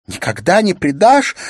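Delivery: high, loud outcry of a wife; tearful shout. A narrator's reading. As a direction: throw it back as a shouted, hurt question. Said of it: "закричала Улла со всхлипыванием".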